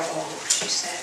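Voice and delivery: ordinary delivery, nothing marked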